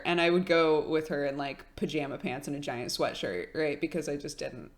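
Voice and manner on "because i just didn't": ordinary delivery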